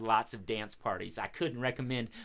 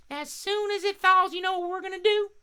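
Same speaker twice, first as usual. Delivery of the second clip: very high, chatty bird voice; friendly crow puppet; 4 dB louder than usual. Pretend. Crow telling host how birds keep warm, high puppet voice. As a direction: explain things as a little cartoon crow.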